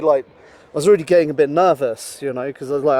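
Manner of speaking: ordinary talk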